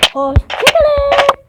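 high, loud, drawn-out farewell; sign-off shout. Real, not acted. Player ending video for viewers, long shouted goodbye.

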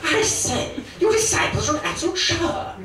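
high plummy upper-class cad; hammed-up posh cad voice; Terry Thomas impression. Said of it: "Terry Thomas as Christ".

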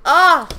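short, loud, high "ah" of delight; delighted cry. Real, not acted. Vlogger thrilled by a creative slime, delighted cry. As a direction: burst out with an excited exclamation.

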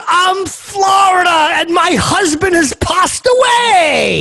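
slow, high, shouted lament; mock dramatic widow. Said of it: "And then she goes".

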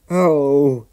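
high, loud shivery cry; mildly acted shiver of dismay. A narrator's reading. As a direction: exclaim as if caught by cold rain. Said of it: "he shivered".

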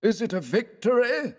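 high-pitched wondering question; murmuring onlooker. A narrator's reading. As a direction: ask it in a high, thin onlooker's voice. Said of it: "murmured one".

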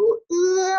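呃 held high and level; long 呃 with no pitch movement; first tone. Shown as a demonstration. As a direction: sustain 呃 on one even note. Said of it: "一声平".

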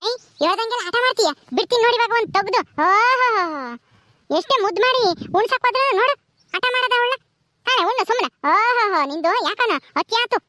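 high-pitched exasperated telling-off; scolding. Acted. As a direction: scold a stubborn child. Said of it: "ನೀನ್ ಹಿಂಗೆ ಬೈದ್ರೆ".

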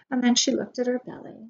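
ordinary delivery, nothing marked

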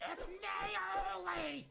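high, slow, squeaky put-on voice correcting a name; mock character reply. Streamer voices the shop character correcting her name, high and slow. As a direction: stretch out the name in a high cartoon voice.

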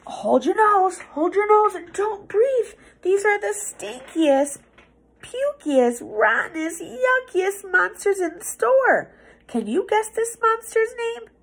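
high, pinched grossed-out voice; disgusted. Acted. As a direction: squeal about the stink. Reacting to stinky monsters.

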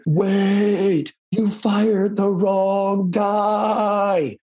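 high, hugely stretched mock yell; slow-motion movie shout. PA running up to stop the van leaving, slow-motion shout. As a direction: call it out in movie slow motion, every word dragged long. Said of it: "if it was a movie, they'd be running in slow motion".

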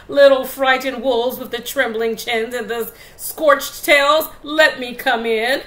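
loud, menacing pig; big bad pig voice.

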